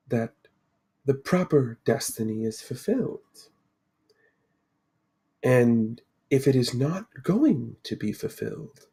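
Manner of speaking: slow, measured mystic seer; mysterious Lady of the Lake. Acted. Lady introduces herself to four adventurers, solemnly mysterious. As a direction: unfold it slowly, with weighty pauses. Game master voices the Lady.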